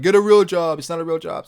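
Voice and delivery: high, loud mock scold of nagging viewers. Streamer mimicking viewers who say get a real job, loud and high. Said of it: "where they're like".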